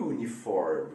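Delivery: "uniform" said with a clear you sound at the start; correct English uniform. Shown as a demonstration. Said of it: "a pronúncia dele é U". ("uniform" com som de iu no início)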